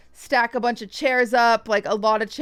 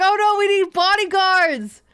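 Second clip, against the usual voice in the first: mock panic; 8 semitones higher, 4 dB louder than usual. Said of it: "I was like".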